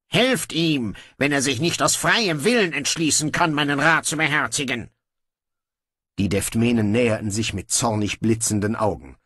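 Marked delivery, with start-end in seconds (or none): 0.0-4.9 higher, breezy royal voice; a lazy king giving in; offhand young king. Acted. Brocando grudgingly orders guards to release his brother, breezy.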